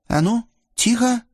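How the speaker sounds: sudden high-pitched order; alarmed command for silence; urgent hushing. A narrator's reading. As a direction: cut him off with a warning. Ksenia suddenly silences Yusuf.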